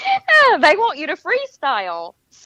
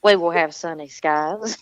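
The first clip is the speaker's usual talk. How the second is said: Southern accent; 7 semitones lower than usual. Shown as a demonstration. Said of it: "get you to say".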